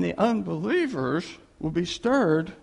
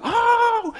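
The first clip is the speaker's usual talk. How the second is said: long, loud, high-pitched exclamation; acted, held high cry; 7 dB louder than usual. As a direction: burst into a drawn-out high "ohh". Acts out exclamation before the catchphrase.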